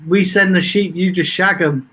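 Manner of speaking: normal talking voice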